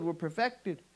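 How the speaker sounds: ordinary talk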